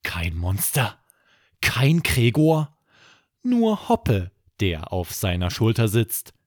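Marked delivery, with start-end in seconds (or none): none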